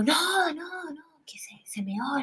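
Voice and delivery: very soft, half-whispered aside; retold hushed confiding. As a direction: break the news under your breath. Retold confiding secret to sister. (contando el secreto en voz bajita)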